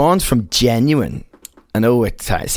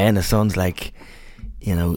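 ordinary delivery

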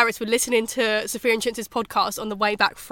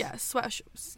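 whisper; 13 dB quieter, much slower than usual. Real, not acted. Whispering the word.

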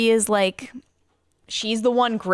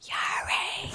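soft, unvoiced character whisper; hushed call for attention; 10 dB quieter than usual. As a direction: whisper the name across to a friend. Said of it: "She whispers".

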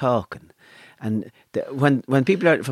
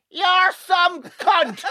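high, drawn-out comic yell; slow, high-pitched mock shout; retold shouted insult; much slower than usual. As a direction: stretch it out high, like a mock bellow across a room. Retells a man's shouted greeting insult.

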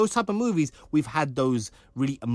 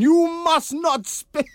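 mock guard command; 6 dB louder, slower than usual. Guards bark at agent.

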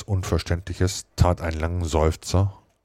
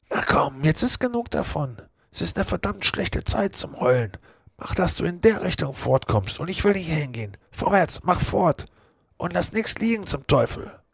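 much higher, impatient commands; brusque Joe; 13 semitones higher than usual. Acted. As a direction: hurry him off roughly. Joe orders him away.

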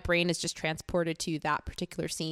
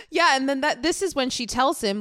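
ordinary talk

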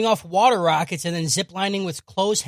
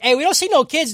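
mock caller; 9 semitones higher, 8 dB louder than usual. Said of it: "and they're like".

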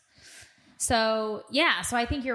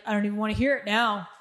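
mock sulky husband; faster than usual. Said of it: "And then he's like".

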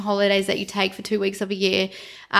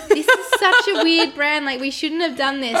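laughing self-mockery; 6 semitones higher than usual. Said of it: "sometimes we were like".

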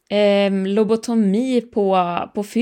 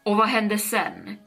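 ordinary delivery, nothing marked

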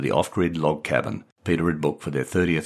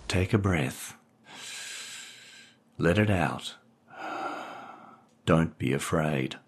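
soft self-soothing; 7 dB quieter, slower than usual. Acted. Repeating a calming mantra alone.

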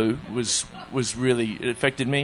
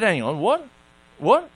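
incredulous, second "what" leaping much higher; taken aback; 7 semitones higher than usual. Retold or imitated. Guest retelling being told he's banned, incredulous. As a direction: react in disbelief, pitch jumping up on the repeat.

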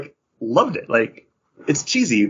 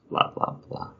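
dismissive mumble; 10 dB quieter than usual. Real, not acted. Skipping the rest of the bio.

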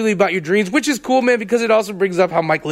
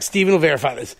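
ordinary talk, nothing marked

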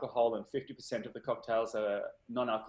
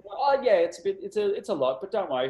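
chirpy reply; 6 semitones higher, 7 dB louder than usual. Retold or imitated. Bartender's cheery reply in retold story.

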